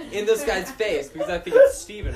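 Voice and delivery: usual speaking voice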